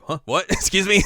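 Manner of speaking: high, loud mock bewilderment; startled disbelief. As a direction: react as if caught totally off guard. Acting a startled brother.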